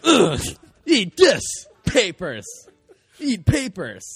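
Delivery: high, loud hammed-up yell; mock furious shout. Mocking a doubter, shouting at them to eat the papers, hammed-up rage. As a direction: shove the papers at them, yelling.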